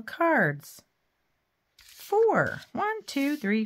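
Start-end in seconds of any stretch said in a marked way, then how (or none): 1.8-3.6 high, loud proud exclaim. Real, not acted. Crafter counting her finished cards, exclaiming proudly. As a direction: announce it like a triumph.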